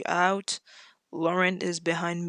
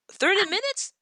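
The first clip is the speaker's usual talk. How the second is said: high, louder thirty minutes with a rise; be shocked at the time; retold astonishment at how long it took; astonished question; 13 semitones higher, 4 dB louder than usual. Retold surprise at the drive.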